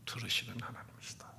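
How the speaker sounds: soft, voiceless whisper; whispered affirmation. Real, not acted. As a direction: let it fall to a whisper. Quiet restatement after refrain.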